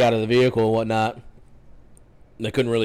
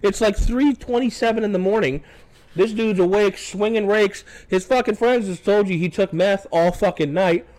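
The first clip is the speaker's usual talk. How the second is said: high-pitched, exasperated arguing; worked-up retold rant; indignant protest; 7 semitones higher than usual. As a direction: imitate yourself arguing in disbelief, voice pitched well up. Retold argument with the deputy.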